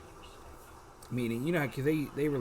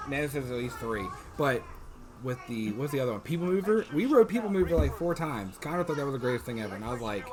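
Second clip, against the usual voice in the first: normal talking voice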